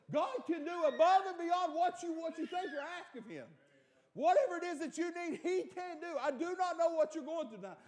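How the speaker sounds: high, shouted preaching; preaching at full force. Acted. Preacher urging the congregation, shouting at full force. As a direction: build it to a shout.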